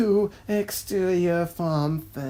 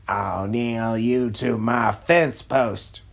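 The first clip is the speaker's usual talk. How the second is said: lower, menacing farmer voice; gruff farmer threat; 7 semitones lower than usual. Acted. Farmer John warns the duck off his strawberries, low and menacing. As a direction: growl the warning down low. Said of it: "Farmer John".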